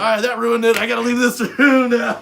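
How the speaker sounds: loud and high, with a laugh; a mock-fed-up loud exit line; exasperated exit. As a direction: announce you're leaving, fed up. Exasperated reply retold.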